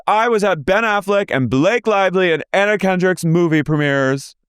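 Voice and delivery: name-drop proudly; high parody of bragging; imitating a castmate.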